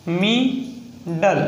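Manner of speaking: Middle very slow, split into "mi... dal"; sounding out. Shown as a demonstration. Teacher breaking an example word down for learners, slow syllables. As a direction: stretch both syllables of middle, pausing between.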